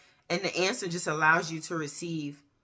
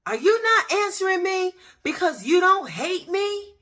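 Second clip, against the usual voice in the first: incredulous hope; 8 dB louder than usual. Retold or imitated. Sudden hope after silence in prayer.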